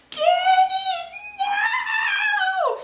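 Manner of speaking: very high, loud cry, laughing through it; desperate laughing protest; frantic shout. Retold or imitated. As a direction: yell him down in alarm. Running to stop friend.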